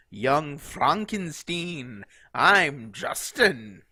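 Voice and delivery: slow, loud Transylvanian count voice; booming Transylvanian vampire accent. Pretend. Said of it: "you like my Transylvanian accent?".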